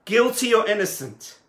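loud call to the crowd. Acted. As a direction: put the question to the whole town. Tzemach Tzedek calls to the town.

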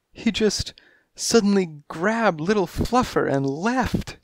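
very high, pained kittenish voice; high feline wail of a deserted mother; anguished cat lady. A narrator's reading. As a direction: plead your loss, pitched high.

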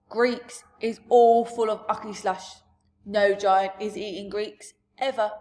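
a big friendly giant holding forth; giant's loud booming talk. Acted.

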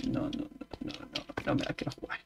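fast whispered refusal. Shown as a demonstration. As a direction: hiss it quietly and quickly.

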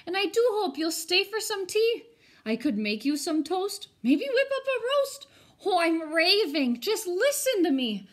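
a lonely ghost babbling with delight over tea; gushing ghost. Acted. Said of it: "said the ghost".

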